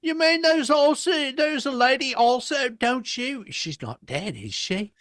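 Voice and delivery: voicing a child. Playing twin asking Wendy.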